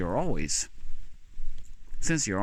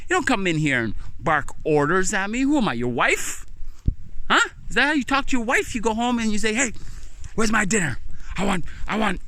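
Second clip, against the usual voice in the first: much higher and louder, telling him off; angry tirade; 12 semitones higher, 3 dB louder, faster than usual. Acted. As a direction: scold the supervisor hard. Telling off the boss.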